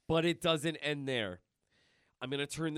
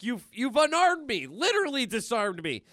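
much higher, louder hammed-up quip; Deadpool punning; 10 semitones higher, 7 dB louder than usual. Deadpool joking after losing his arm, high loud ham. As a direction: ham up the pun. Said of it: "he's like".

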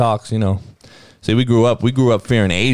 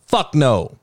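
ordinary talk, nothing marked

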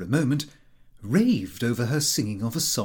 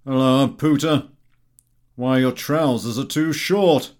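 loud, jeering friend; brash teasing voice; rude Gowing; 7 dB louder than usual. A narrator's reading. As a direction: bark it out like a mocking chum. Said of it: "greeted me with".

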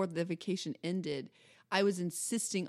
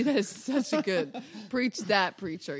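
laughing; 5 dB louder, slower than usual. Pretend. Hamming up praise, laughing.

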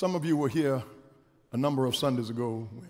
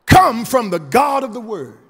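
forceful preacher cadence, key words drawn out; preaching; 15 dB louder than usual. Real, not acted. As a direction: punch the key words high and loud, then let each phrase fall. Preaches to congregation.

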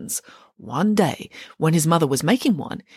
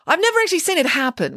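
Nanny Piggins voice; 10 semitones higher, faster than usual. Acted.

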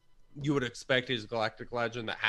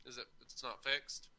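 far from mic; 11 dB quieter than usual. Real, not acted.

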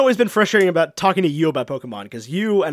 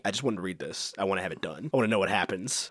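tag-along inner thought; 9 semitones lower, 12 dB quieter than usual. Acted. Voices impatient reader's thoughts.

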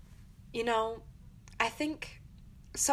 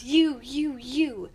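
louder jabbing you, you; mock jabbing accusation; 3 semitones higher, 7 dB louder, much slower than usual. Shown as a demonstration. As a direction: stab each you like a finger poke. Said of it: "That is not".

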